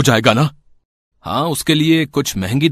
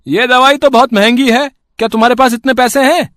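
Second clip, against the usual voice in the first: much higher, sneering shopkeeper voice; greedy shopkeeper; 12 semitones higher, 5 dB louder than usual. Acted. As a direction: look down on a poor customer, high and sneering. (लालची दुकानदार की बहुत ऊँची, तिरस्कार भरी आवाज़)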